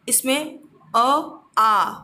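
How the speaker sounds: "अ" clipped short, then "आ" held long and loud; short and long vowel. Shown as a demonstration. Teacher contrasts short "अ" and long "आ" for the class. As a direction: contrast a quick "अ" with a stretched "आ". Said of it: "अ में हम कम समय लग रहा है".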